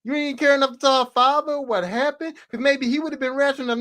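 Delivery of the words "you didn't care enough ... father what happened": usual speaking voice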